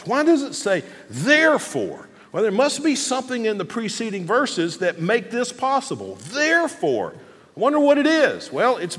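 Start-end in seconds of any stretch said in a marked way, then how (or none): 1.1-2.1 Therefore stretched out slowly; slow, pointed emphasis on Therefore; stress on THEREFORE. Shown as a demonstration. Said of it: "emphasize a different word every time". 6.3-7.1 Therefore pitched high, then falling; high, pointed Therefore; stress on THEREFORE. Shown as a demonstration. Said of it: "emphasize a different word every time".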